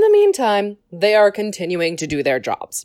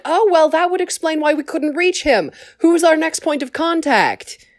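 lightly voiced Lama Su, higher and chirpy; bright helpful alien asking who to call; polite Kaminoan; 7 semitones higher than usual. Lama Su asking the Council for a contact, higher voice. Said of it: "Lama Su is like".

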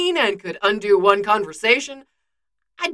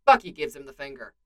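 ordinary delivery

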